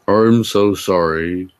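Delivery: slow, timid-sounding apology; mock shy voice. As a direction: apologize like a bashful boy, hammed-up. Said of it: "he said in a shy voice".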